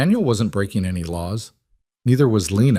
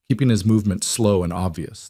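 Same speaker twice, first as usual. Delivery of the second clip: plain reading voice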